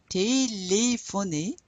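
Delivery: "Téléphoner" slowly, each é a clear "ay"; accented E sounds. Shown as a demonstration. Teacher showing students the accent aigu, "Téléphoner" with clear "ay" sounds. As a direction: draw out "Téléphoner" so every accented E rings as "ay". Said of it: "those are pronounced E".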